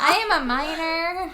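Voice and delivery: high, loud, drawn-out protest; exaggerated mock protest. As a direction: ham it up, slow and loud. Same protest repeated, hammed up.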